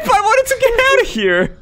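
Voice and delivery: high, loud defensive squeal; indignant squeal. Real, not acted. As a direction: protest in a squeaky yell.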